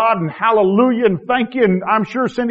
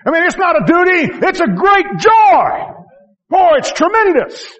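high, loud joyful proclaiming; fervent preaching; 12 semitones higher, 5 dB louder than usual. Acted. Preacher to congregation, joyful loud proclaiming. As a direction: lift it up with big joy.

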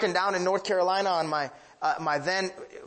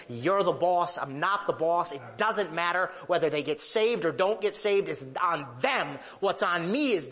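ordinary delivery

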